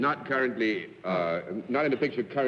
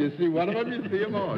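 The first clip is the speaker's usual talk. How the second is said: higher, hammed-up old-timer impression; imitating McCoy; 8 semitones higher than usual. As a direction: echo the guest like an old codger. Host imitates the catchphrase.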